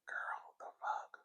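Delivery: breathless, stunned whisper; shocked whisper. Real, not acted. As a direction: whisper it in disbelief. Stunned by brother's death story.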